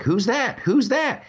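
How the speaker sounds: high-pitched, playful questioning; quick, rising mock excitement; excited imitation. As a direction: mimic someone teasing out a happy surprise. Imitates person filming the reunion.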